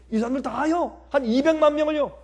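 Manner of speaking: 높은 목소리로 되묻는 모세 연기; 믿기지 않는 듯 높여 묻기; 놀란 되물음. Acted. Acted Moses questioning God's order. (어리둥절한 모세 흉내)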